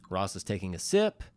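normal talking voice

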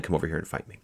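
normal talking voice

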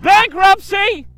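high, slow, loud drawn out; slow loud announce. Pretend. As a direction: declare it grandly. Mock Monopoly call.

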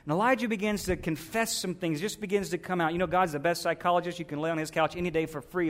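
ordinary delivery

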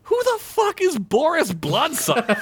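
very high, bewildered Fred; baffled high voice. Acted. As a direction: squeak out the confusion.

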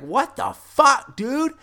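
high-pitched, drawn-out disbelief; incredulous protest. Retold or imitated. Friend protesting as others get let in, incredulous. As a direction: stretch it out high, mock outrage.

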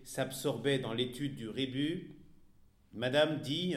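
ordinary delivery

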